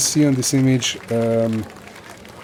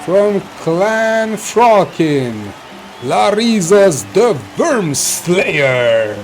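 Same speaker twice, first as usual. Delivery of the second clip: very slow, higher booming call; announcer; 6 semitones higher, 5 dB louder, much slower than usual. Acted. The announcer presents the Worm Slayer, slow and booming. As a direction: stretch each word out for the arena. Announcer introduces next contestant.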